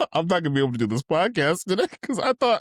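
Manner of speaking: talking through laughter, pitch climbing high; high-pitched, giggly retelling; laughing. Real, not acted. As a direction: laugh your way through the confession. Laughing while retelling his panic.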